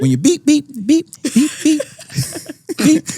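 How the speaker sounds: high squeaky beep, beep, beep; a laughing, high-pitched mock censor bleep; censor beep imitation. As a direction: play the bleep machine with your voice.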